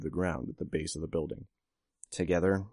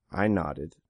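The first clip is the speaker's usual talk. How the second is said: usual speaking voice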